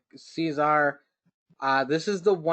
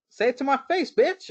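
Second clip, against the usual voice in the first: mock tough taunt; 11 semitones higher than usual. Co-host retelling Finn's threat to Grimes, mock tough taunt. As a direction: taunt it like a wrestler calling someone out. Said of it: "Finn shows up and is like".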